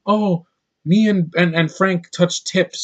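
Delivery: put-on drawn-out girly coo; feminine bun voice. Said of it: "she's like".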